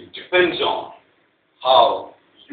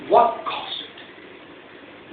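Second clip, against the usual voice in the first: drawn-out question; 8 semitones higher, much slower than usual. Shown as a demonstration. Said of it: "rhetorical question twice".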